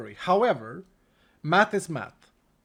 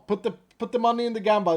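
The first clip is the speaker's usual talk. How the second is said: ordinary talk, nothing marked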